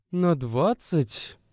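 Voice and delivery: mild incredulous echo; surprised. A narrator's reading. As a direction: stretch it out as if she can't believe it. Woman disbelieving the exact minutes.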